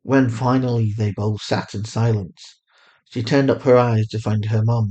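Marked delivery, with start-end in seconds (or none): none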